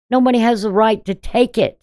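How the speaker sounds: ordinary talk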